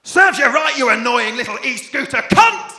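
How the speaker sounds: furious loud high shout; road rage. Acted. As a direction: scream at the scooter rider.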